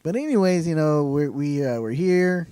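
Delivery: Texan country drawl; country accent. Real, not acted. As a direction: lay on a country twang. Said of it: "You sounded so country right now".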